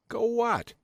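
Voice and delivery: high, startled "what?"; thrown, asking it high; startled question. A narrator's reading. Narrator's startled reply.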